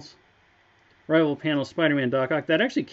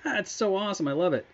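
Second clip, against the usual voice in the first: delighted reaction; 7 semitones higher than usual. Retold or imitated. Designer imitates Dallas reacting to his idea, delighted. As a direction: imitate a colleague loving the idea.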